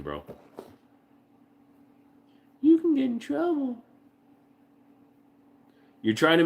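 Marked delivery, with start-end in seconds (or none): 2.6-3.8 high teasing voice. Pretend.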